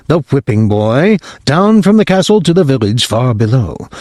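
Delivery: ordinary delivery, nothing marked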